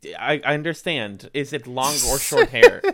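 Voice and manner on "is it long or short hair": laughing question. Real, not acted.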